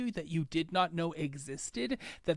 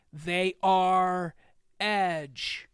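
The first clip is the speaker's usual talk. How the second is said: louder, ringing name call; mayor announcing the first hero, louder; announcer call; 6 dB louder, much slower than usual. Acted.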